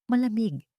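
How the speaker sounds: ordinary delivery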